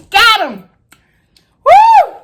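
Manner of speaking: high loud victory whoop; triumphant cheer. Real, not acted. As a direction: cheer like you just won. Caught it.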